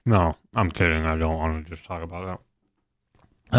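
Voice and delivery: talking with mouth full; chewing through the words; messy loud eating. Real, not acted. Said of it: "talking with my mouth full".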